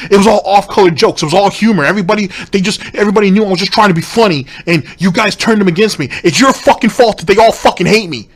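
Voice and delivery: mocking Phil. Streamer mocking Phil's excuses to his viewers, whiny parody.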